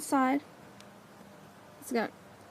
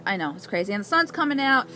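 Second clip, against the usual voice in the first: ordinary talk, nothing marked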